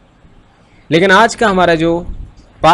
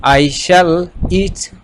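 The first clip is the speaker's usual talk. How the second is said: slow model sentence; 2 semitones higher, much slower than usual. Shown as a demonstration. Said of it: "तो इनका तर्जमा यूँ बनेगा".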